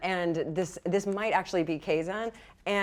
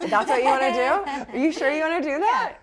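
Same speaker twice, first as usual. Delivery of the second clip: laughing; 6 semitones higher, 5 dB louder than usual. Real, not acted. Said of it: "Oh boy".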